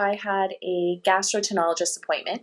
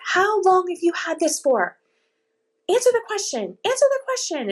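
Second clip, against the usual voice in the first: imitating an impatient doctor, much higher and climbing higher; aggravated rude doctor; 12 semitones higher than usual. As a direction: mimic a rude doctor demanding an answer, pitch rising. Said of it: "he was getting aggravated with me and very, like, rude".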